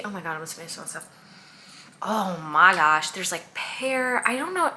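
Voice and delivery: usual speaking voice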